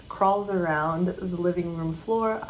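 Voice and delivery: normal talking voice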